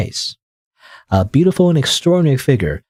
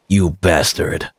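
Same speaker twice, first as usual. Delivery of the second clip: villain snarl; 6 semitones lower, slower than usual. Acted. Monster's snarl at narrator.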